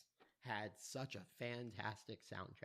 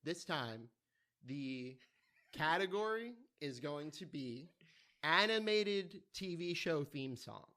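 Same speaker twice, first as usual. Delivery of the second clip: game show announcer; 4 semitones higher, 5 dB louder than usual. Acted. Opening a game show segment.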